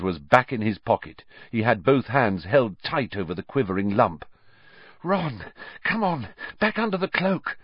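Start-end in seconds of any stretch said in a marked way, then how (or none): 5.0-7.6 higher, breathless urging; panting. A narrator's reading. Hermione out of breath from the sprint, panting. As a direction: pant the words. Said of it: "Hermione panted".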